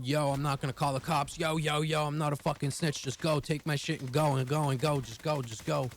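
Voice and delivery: retold screaming. Pinned on the ground, yelling up at the two robbers. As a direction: beg the robbers to just take it and go, yelling. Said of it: "And I'm screaming".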